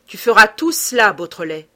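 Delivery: "Tu feras tout cela" punched loud, word by word; loud, measured stresses on "Tu feras tout cela"; hammered out. A narrator's reading. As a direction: beat out "Tu feras tout cela" as an order, then drop on "Beautrelet". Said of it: "et scanda".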